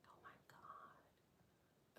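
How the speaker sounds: slow breathy whisper of amazement; barely voiced oh my God; hushed amazement; awed whisper. Real, not acted. Awed reaction.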